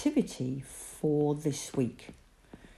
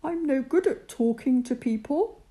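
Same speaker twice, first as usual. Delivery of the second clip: shy Moses; 8 semitones higher than usual. Acted. Moses pleads shyness.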